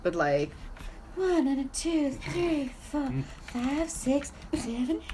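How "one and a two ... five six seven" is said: high, rhythmic dance teacher count. Retold or imitated. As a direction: count the dancers in, stretching the last beats.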